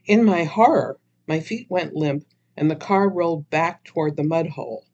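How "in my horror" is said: ordinary delivery, nothing marked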